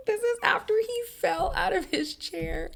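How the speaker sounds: high incredulous squeal; squeaky disbelief. Real, not acted. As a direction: shriek it out, can't believe it.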